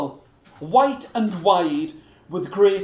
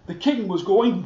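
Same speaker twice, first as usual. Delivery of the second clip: ordinary delivery, nothing marked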